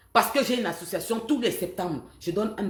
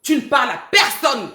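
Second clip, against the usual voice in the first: retold order; 5 dB louder than usual. Repeating what she told Marie Sandra, shouting. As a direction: bark the order she once gave. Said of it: "Je lui avais demandé".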